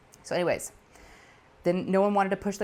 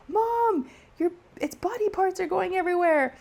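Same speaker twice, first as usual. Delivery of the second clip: daughter screaming; 12 semitones higher than usual. Retold or imitated. Daughter yelling at mom about flying bug parts, high mock scream. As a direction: imitate a daughter shrieking in disgust. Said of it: "Lou starts screaming".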